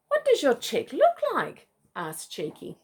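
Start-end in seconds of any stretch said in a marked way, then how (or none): 0.1-1.7 possum voice. A narrator's reading.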